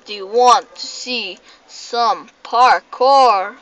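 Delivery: loud, slow, drawn-out words; slow showy announcement. Real, not acted. Gamer offering viewers parkour, slow showy announcement. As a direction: announce each word slowly and loudly. Hyped second take to viewers.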